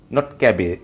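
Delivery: usual speaking voice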